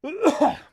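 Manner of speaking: loud real throat-clearing cough; dry-throat cough. Real, not acted. Streamer choking on air mid-stream, loud dry cough. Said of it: "my throat's dry".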